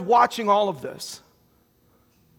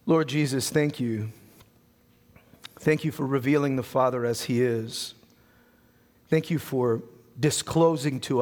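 soft, lower, unhurried prayer voice; 7 semitones lower, 4 dB quieter than usual. Real, not acted. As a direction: pray gently with pauses. Leads closing prayer.

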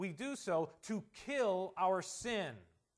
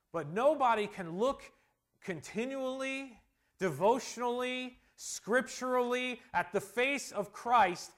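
raised, emphatic preacher's voice; impassioned preaching; 6 semitones higher, 7 dB louder than usual. Real, not acted. Preacher tells church what gazing at Christ does, impassioned preaching. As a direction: drive the point home to a congregation.

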